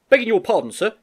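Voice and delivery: put-on high, loud young soldier; David speaking up. David backing his friend before the Major, high and insistent. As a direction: speak up boldly but respectfully. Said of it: "David spoke up now in support".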